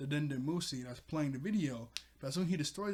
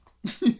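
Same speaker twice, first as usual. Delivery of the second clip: sudden loud high laugh; real loud cackle of amusement; 9 semitones higher, 15 dB louder than usual. Real, not acted. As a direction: burst out laughing. Laughing at own joke.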